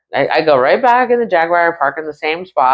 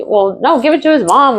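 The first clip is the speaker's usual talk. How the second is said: pleading protest; 11 semitones higher than usual. Retold or imitated. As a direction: push back, pitched up. Arguing over impound.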